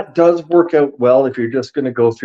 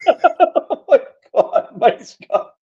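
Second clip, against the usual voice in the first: laughing; 11 semitones higher, slower than usual. Real, not acted. Laughing at Mike's thumbs trick.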